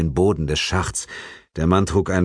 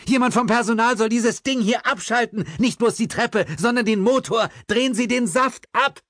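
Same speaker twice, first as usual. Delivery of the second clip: higher policewoman voice giving urgent commands; urgent orders. A narrator's reading. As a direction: order the staff to cut the power. Policewoman shouts orders upward.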